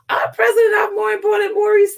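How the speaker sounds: high, loud outburst; indignant shout. Real, not acted. Defending the president to the British press, indignant shout. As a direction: shout it like it's obvious.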